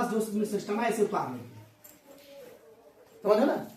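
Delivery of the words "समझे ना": usual speaking voice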